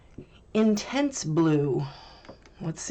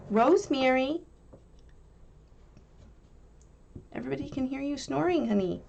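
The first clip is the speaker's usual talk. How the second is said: higher, fast teasing call to the dog; teasing pet; 8 semitones higher than usual. Real, not acted. Owner teasing her snoring dog Rosemary, affectionate and higher. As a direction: tease the sleeping dog sweetly.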